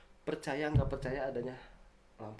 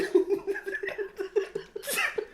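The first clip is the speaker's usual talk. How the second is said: high laugh; 4 dB louder than usual. Real, not acted. Laughs after his impression.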